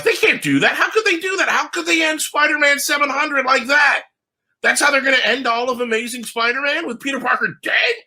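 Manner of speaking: high, frantic outraged fan dad; freaking out. Retold or imitated. Dad reading to son, shocked by ending, frantic. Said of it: "Max's dad freaks out".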